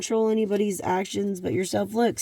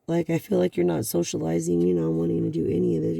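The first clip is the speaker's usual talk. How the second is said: usual speaking voice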